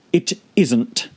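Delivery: a lightly voiced lazy creature; caterpillar voice. A narrator's reading. Caterpillar dismissing Alice, lightly voiced. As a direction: let it hang, unbothered.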